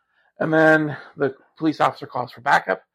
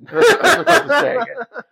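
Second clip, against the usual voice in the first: laughing through the words; 14 semitones higher, 7 dB louder than usual. Real, not acted. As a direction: giggle the line out. Repeats partner's line laughing.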